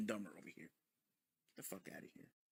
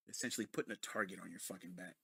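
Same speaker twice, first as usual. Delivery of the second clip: normal talking voice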